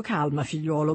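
ordinary delivery, nothing marked

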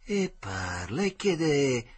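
ordinary delivery, nothing marked

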